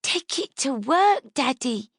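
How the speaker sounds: put-on high, slow little girl's plea; innocent child voice. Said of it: "Her innocent voice".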